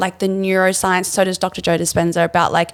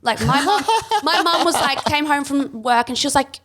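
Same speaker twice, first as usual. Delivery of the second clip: ordinary talk, nothing marked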